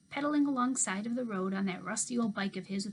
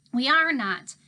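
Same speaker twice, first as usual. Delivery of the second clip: firm denial; 4 semitones higher, 11 dB louder, slower than usual. Acted. Georgina snaps at scared brother.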